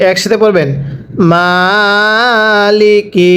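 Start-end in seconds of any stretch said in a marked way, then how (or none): 1.3-3.3 Maliki stretched very slowly; teacher models Maliki slowly; slow drawn-out reading. Shown as a demonstration. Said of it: "আরও একবার পড়বেন".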